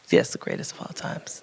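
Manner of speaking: breathy whisper of the phrase. Real, not acted. Author whispers the title to the audience. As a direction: whisper it like a secret aside.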